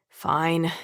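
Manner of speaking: mildly exasperated concession; a tad exasperated. A narrator's reading. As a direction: give in with slight impatience. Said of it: "He sounds a tad bit exasperated".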